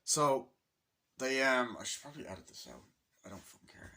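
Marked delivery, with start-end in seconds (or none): 1.8-3.9 breathy whispered shrug of an aside; hushed couldn't-care-less mutter. Real, not acted. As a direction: whisper it dismissively to yourself. Aside about editing the video.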